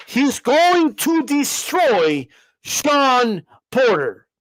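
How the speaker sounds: very slow, each word weighed; calm, drawn-out certainty; slow confident call. Shown as a demonstration. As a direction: drag out the prediction with total certainty. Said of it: "say that like this".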